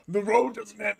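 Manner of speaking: high-pitched character voice; put-on player voice. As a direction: voice the player's quote, pitched well up. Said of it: "I forgot to do the voices".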